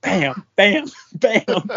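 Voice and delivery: punchy mock shots through laughter; high, laughing gunfire imitation; imitated gunshots. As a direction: fire off bams, cracking up. Imitates buddy's gunshots while laughing.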